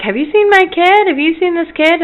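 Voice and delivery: high, urgent retold plea; retold worried searching; frantic asking. Retold frantic search for lost daughter.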